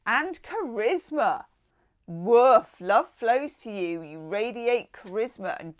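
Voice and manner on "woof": loud, punchy "Woof" falling off; woof exclamation. Real, not acted. Tarot reader reacting to a hot card, loud woof. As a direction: blurt out an impressed woof.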